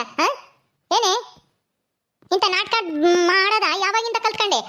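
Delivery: ordinary delivery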